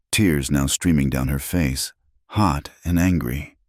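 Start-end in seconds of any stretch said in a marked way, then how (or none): none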